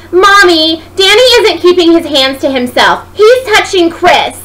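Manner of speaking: put-on high, loud little girl's voice telling on him; tattling child. Little Sarah telling her mommy on Danny, high loud child voice. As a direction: tattle like a gleeful toddler.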